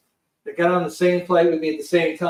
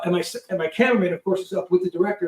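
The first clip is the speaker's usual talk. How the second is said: usual speaking voice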